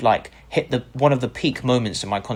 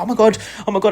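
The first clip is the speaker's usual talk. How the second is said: high, louder starstruck gasping; mock freaking out; 11 semitones higher, 4 dB louder, much faster than usual. Imitating an opponent freaking out at meeting him. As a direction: gush like a starstruck fan. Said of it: "he started freaking out".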